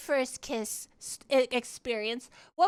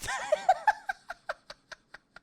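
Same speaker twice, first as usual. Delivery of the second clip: high squealing laugh. Real, not acted. Streamer retelling her first kiss, squealing laughter. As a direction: cackle helplessly at the memory.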